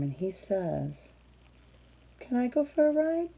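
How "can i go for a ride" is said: higher, quick eager request; lightly voiced Wolf. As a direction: ask fast for a ride. Wolf asks Worm for ride.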